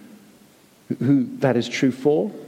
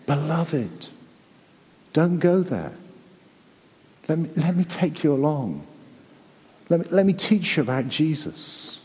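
tender pleading. Retold or imitated. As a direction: coax them back gently, like a loving father. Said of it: "that's what God is saying here".

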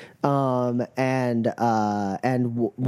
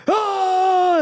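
high, loud charging yell; battle cry; 5 dB louder than usual. Acted. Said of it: "and goes".

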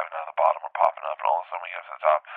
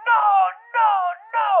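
crowd shouting; 7 dB louder than usual. Retold or imitated. Crowd on shore pleading to the sky, shouting high. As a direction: wail it upward, loud and spaced out.

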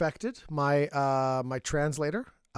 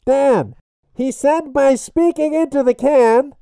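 tin can phone; 8 semitones higher, 9 dB louder, slower than usual. Acted. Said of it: "all muffled by the can".

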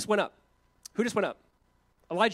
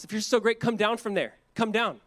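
raised, taunting challenge; mocking; 5 semitones higher, 4 dB louder than usual. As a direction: jeer like a hostile onlooker. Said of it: "when he's being mocked on the cross".

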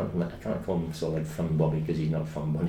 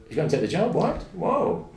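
high-pitched mock astonishment; rising disbelief, climbing higher to a whoa; shocked; 11 semitones higher than usual. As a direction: act out someone stunned by news. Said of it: "He got a shock".

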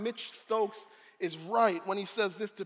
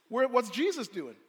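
ordinary talk

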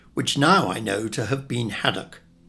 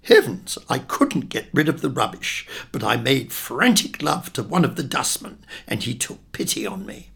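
plain reading voice

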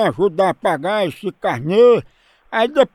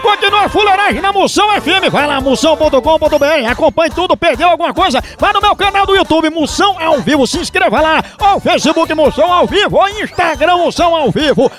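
higher, energetic announcer plug for the channels; radio announcer sign-off; 6 semitones higher, 3 dB louder than usual. Acted. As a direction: pump up the listeners as you plug the channels. Host signs off the show. (locução animada de rádio)

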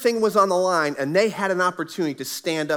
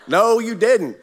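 higher, louder hammed-up disbelief; loud mock indignation; 4 semitones higher, 6 dB louder, slower than usual. Husband replaying his outrage at neighbor's question, loud mock indignation. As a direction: punch it up as a mock-outraged comeback. Repeats indignant reaction, bigger.

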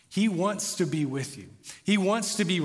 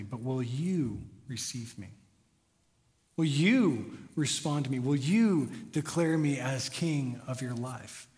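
soft, slow and lower, pleading gently; tender plea; 5 semitones lower, 4 dB quieter, slower than usual. Pretend. Jesus gently inviting the listener, soft tender plea. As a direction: ask it softly like a longing friend.

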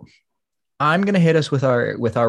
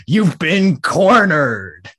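slow, drawn-out WWE announcer voice; wrestling promo; 4 semitones higher, 4 dB louder, much slower than usual. Shown as a demonstration. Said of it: "your best W W E voice".

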